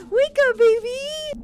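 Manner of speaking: high, drawn-out wake-up call; calling to wake baby. Pretend. Player to the game's baby, high singsong wake-up call. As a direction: stretch it out high, coaxing a baby awake.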